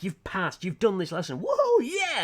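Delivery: high, loud hammed-up whoa yeah; energetic app cheer. Mimicking the energetic app characters, hammed-up excitement. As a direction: burst into over-the-top celebration. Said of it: "all the others are energetic".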